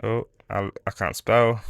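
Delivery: ordinary talk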